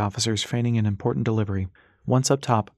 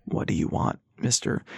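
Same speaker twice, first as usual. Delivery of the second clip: wary low whisper; 5 semitones lower, 4 dB quieter than usual. Acted. Ras wary of stranger.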